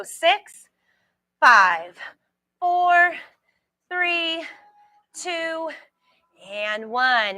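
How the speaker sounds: punchy countdown, pitch jumping; countdown call. Real, not acted. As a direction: coach counting down reps. Instructor counting down reps.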